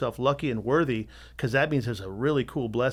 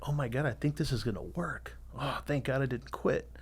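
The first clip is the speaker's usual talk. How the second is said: relieved murmur; 7 dB quieter than usual. Retold or imitated. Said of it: "So I'm like".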